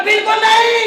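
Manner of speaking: emphatic refusal. Retold or imitated. Monk refuses.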